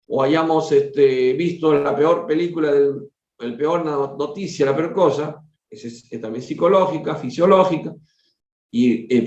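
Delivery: plain reading voice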